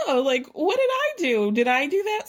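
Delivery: high, falsely innocent squeak; innocent shrug voice. Retold or imitated. [name] mimics Vicki's shrug, high mock innocence. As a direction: play dumb in a high mock whine. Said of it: "she'll do that shrug".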